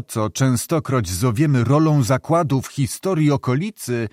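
usual speaking voice

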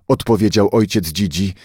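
ordinary delivery

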